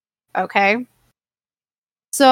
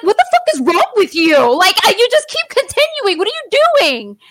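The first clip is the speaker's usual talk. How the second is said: higher, louder yell of disbelief; retold angry yell; 10 semitones higher, 4 dB louder than usual. Wife reliving yelling at husband who keeps powdering her, high and loud. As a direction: shout it like you've been powdered again.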